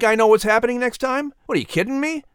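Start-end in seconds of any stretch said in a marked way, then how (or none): none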